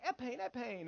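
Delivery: "Airplane, airplane" with consonants dropped, like "eh-pane"; childhood speech impediment. Retold or imitated. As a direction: imitate a small child who can't pronounce "Airplane". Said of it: "I couldn't speak that good".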